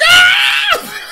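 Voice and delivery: high, loud mock scream breaking into laughter; laughing scream. Kids scream when lights go out, laughing shriek. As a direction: shriek like a scared kid, laughing. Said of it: "me and my sister would be like".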